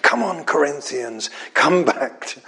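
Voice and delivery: ordinary talk, nothing marked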